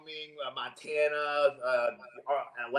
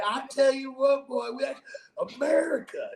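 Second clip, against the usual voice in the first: put-on Kevin Kisner Southern accent; 8 semitones higher than usual. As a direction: lay on a thick Southern accent, pitched up and unhurried. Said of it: "he sounded like Kevin Kisner on, steroids".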